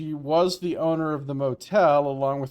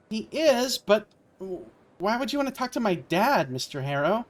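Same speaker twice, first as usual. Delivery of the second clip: higher, lightly anxious receptionist; hint of panic; 8 semitones higher than usual. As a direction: let a touch of panic into a higher voice. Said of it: "There's a hint of panic in her voice".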